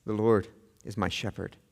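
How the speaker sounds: hushed tender. Acted. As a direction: speak softly and low as if to one person.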